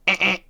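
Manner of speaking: loud high mimicked error buzz; error beep. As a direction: imitate a card reader rejecting. Card terminal denial beep.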